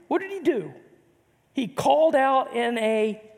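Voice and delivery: ordinary talk, nothing marked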